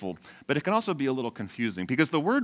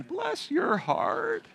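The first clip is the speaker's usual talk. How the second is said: very high, very slow Southern cooing; deep Southern accent; 4 dB quieter, much slower than usual. Acted. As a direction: stretch it out, syrupy older Southern woman, way up high. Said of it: "say it with a deep Southern accent".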